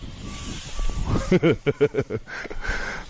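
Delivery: soft unvoiced chuckle; off-mic snicker after ribbing a friend; breathy laugh. Real, not acted. Laughs after teasing rider.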